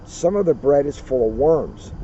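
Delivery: usual speaking voice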